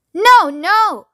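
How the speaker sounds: high, loud refusal; yelling back. A narrator's reading. Mister Wonka refusing the frightened grandparents, yelled. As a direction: yell back over the din. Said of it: "Mister Wonka yelled back".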